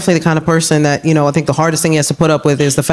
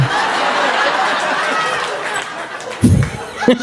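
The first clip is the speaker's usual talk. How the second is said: breathless squeaky laugh; 5 dB quieter than usual. Real, not acted. Silent laugh.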